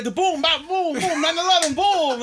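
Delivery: high, loud laughing booms; gleeful high explosion noises; mock explosion sounds. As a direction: shout out the booms like a cartoon blast.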